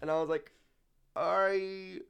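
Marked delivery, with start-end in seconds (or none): 1.2-2.0 put-on old man voice. Deadpan reply to kid.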